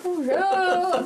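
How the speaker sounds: high, drawn-out mock "crying alone" lament; mock crying wail. Teasing about crying alone at night, mock wail. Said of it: "as you're crying alone at night".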